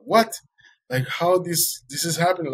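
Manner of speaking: high-pitched, stunned question; disbelieving. Retold or imitated. Swimmer finding the dolphin behind him again, disbelieving. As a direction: retell it in astonished disbelief.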